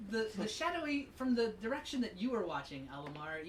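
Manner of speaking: ordinary delivery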